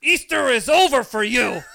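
hammed-up furious mother grabbing her kid; menacing mom. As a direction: growl it like a mom out for blood. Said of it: "she's like".

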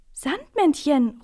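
high, loud, drawn-out delighted cry; excited call. A narrator's reading. Boy spotting the Sandman, calling out excitedly. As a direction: call out the name, thrilled. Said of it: "ruft Max dann begeistert".

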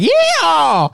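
very high, drawn-out whoop; hammed-up yell. Greeting at an American restaurant in Thailand, hammed-up yell. Restaurant crowd's cheer acted.

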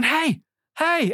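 high, eager calls of hey; calling out. Retold or imitated. Calling out to a face in water.

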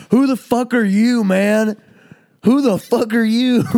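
high, loud mock yell of an angry drunk; imitating a drunk yelling in his face; drunken screaming. Drunk guy screaming at Sam outside the door, high mock yell. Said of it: "the guy is screaming at Sam".

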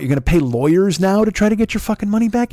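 ordinary delivery

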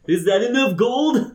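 high, slow question; high bystander. Acted. Bystander asks the merchant, high and slow.

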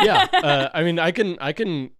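laughing. Real, not acted. Group laughing at teasing.

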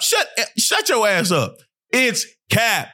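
high-pitched, exasperated telling-off; hammed-up indignation, high voice; mock exasperated rant. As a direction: pitch it right up as you scold them. Mock rant at boastful players.

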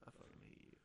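whispered mock insult; whispering. Real, not acted. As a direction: hiss it under your breath.

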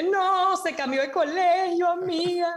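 high, drawn-out mock wail; mock lament. Guest lamenting that the Chilean girl changed schools, mock lament. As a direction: whine it slowly, hammed-up heartbreak.

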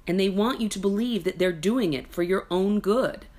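usual speaking voice

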